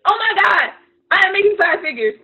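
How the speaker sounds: loud, high, overjoyed "Oh my God"; a mock dreamer celebrating success out loud; ecstatic shout. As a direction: shout it like you just won.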